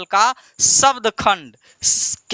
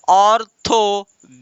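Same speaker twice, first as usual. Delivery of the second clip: "Orthography" split as ऑर, थो, ग्रा, फी with clear gaps; syllable by syllable. Shown as a demonstration. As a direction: say "orthography" in four separate beats. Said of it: "तो एक, दो, तीन, चार". ("Orthography" को चार खंडों में रुक-रुक कर)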